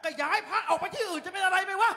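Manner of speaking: loud, angry rebuke; father yelling at his son over the shrine; angry scolding. Acted. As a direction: bawl the son out.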